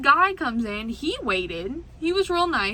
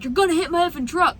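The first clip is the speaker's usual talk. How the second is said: mock angry driver; 6 semitones higher, 5 dB louder, faster than usual.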